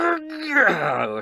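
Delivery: high, loud groan of exertion; straining with effort. Acted. Quinn trying to lift the gate, straining. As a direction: strain as if heaving a huge weight. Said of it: "lifts with all his might".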